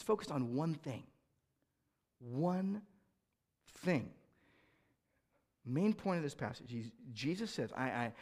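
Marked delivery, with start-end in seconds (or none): none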